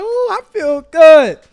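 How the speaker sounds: high, loud, drawn-out delight; feeling good. Acted. Happy person waking up full of energy, elated. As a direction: savour it, stretched and elated. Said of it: "you just feeling good".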